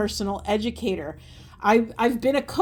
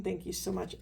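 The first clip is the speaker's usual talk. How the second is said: much softer, murmured thanks to Spirit; 11 dB quieter than usual. Real, not acted. Reader thanking Spirit under her breath, softly. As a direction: breathe the thanks quietly, almost to yourself.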